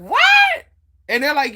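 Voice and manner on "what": shocked what. Retold or imitated. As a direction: blurt it out in disbelief.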